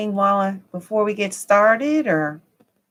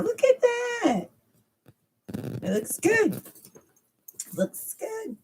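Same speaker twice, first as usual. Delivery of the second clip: excited; 5 dB quieter than usual. Real, not acted. Delighted at screen view.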